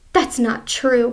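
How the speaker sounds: plain reading voice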